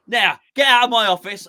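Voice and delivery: hammed-up angry manager; mock-angry dismissal. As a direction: play the boss throwing a player out. Pretend manager throws player out.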